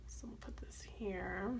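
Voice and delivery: soft, slow whispered aside. Real, not acted. Planner vlogger muttering to herself while writing, whispering.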